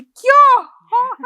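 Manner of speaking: high, loud, drawn-out mock horror; hammed-up cry of disgust; horrified exclamation. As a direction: imitate a child aghast, stretching it out. Daughter's shock at birth video.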